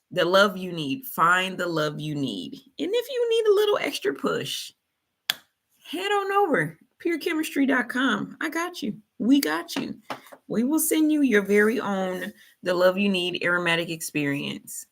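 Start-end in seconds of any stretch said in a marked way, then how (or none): 2.8-12.3 upbeat sponsor plug, much higher; ad read. Acted. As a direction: pitch the website to listeners.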